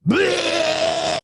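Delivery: loud, high retching yell; a painful, horrific retching cry; vomit purging sound. Retold or imitated. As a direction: belt out a violent heave. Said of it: "Someone's purging".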